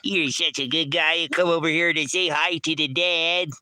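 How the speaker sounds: high sing-song pet voice. Real, not acted. Owner cooing at the dog to come greet dad, high baby talk. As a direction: coo over the good dog.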